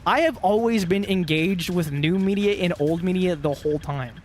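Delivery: normal talking voice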